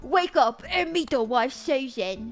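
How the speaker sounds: loud, hammed-up British geezer; British accent. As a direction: put on a broad, bad British accent, loud and playful. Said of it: "I can do that accent".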